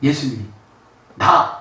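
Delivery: ordinary delivery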